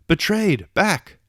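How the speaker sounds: high, urgent cry of alarm; shout. A narrator's reading. Dólokhov warning Kuragin they are betrayed, shouting. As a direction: shout it as a warning, each word pitched high. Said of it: "shouted Dólokhov".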